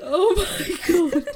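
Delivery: cracking up at the pigeon accent; slow laughing gasp. Real, not acted. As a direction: let it stretch out while laughing.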